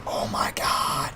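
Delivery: breathless whispered awe; hushed, amazed whisper; awed whisper. Retold or imitated. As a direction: whisper it like you're impressed. Awed at her nice house.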